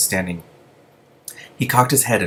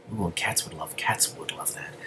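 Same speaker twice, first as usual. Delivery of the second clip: delighted whisper; 13 dB quieter, slower than usual. Real, not acted. Reader's delighted aside.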